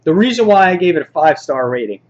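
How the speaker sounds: ordinary delivery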